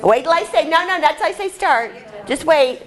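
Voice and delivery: high, urgent teacher call over the group; calling a halt. Real, not acted. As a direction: stop them before they start too soon. Calling out to stop early starters.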